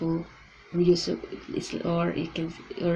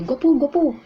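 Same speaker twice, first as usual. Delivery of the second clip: Guwapo, guwapo called out high and louder; calling out; 9 semitones higher, 5 dB louder, much faster than usual. Shown as a demonstration. Teacher shows how someone calls out a compliment. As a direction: call someone's attention sweetly. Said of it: "if somebody calls you".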